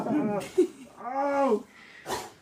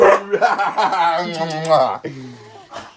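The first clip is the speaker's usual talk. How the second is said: loud laugh; 3 semitones lower, 13 dB louder than usual. Real, not acted. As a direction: burst of laughter after the scare. Laughing after scaring baby.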